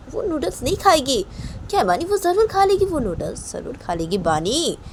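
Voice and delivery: high, swooping, animated coaxing; fussing consoling aunt. Acted. Chhoti maa insists to Bani she'll eat, high animated fussing. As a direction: reassure insistently in a high, singsong voice.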